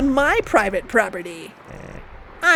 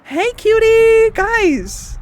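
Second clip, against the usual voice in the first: loud greeting; 11 semitones higher, 4 dB louder, much slower than usual. Real, not acted. Greeting a character loudly.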